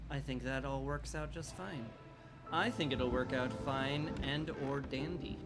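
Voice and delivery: ordinary talk, nothing marked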